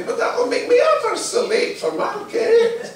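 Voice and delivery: high, loud, laughing complaint; laughing protest. Acted. Harry protests to the old lady, laughing high. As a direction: giggle through the protest.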